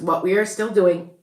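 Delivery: normal talking voice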